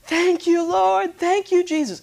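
high, loud ecstatic shouting; a newly saved man screams thanks to God, high ecstatic shouting; screaming praise. Retold or imitated. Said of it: "he's screaming".

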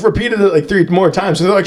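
normal talking voice

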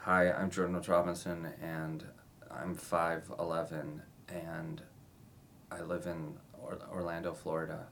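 drain all the energy out of the introduction; a listless slate that mismatches a spirited teen role; deflated slate. Shown as a demonstration. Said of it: "and then during your slate you go".

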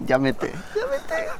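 very high, slow, soft squeal; mock anime moan. Imita gemido japonés de anime. (gemido agudo de anime en broma)

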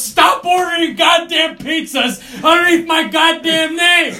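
high, loud, slow shouting, breaking into laughter; retold furious yell. Dean chasing the student with a crutch, shouting. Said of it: "He goes".

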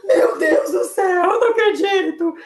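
high, loud, on the verge of crying; near tears. Retold or imitated. Told she's on the wrong bus, she nearly cries. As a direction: voice cracking with disbelief. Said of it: "quase comecei a chorar".

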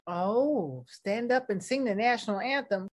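normal talking voice

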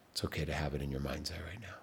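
quick reassuring whisper; hushed soothing aside. Acted. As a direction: whisper comfort mid-hold. Reassurance during breath hold.

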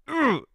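short high-pitched ugh; exasperated little squeal of a groan; frustrated grunt. Real, not acted. Host annoyed at going on about the wrong thing, frustrated grunt.

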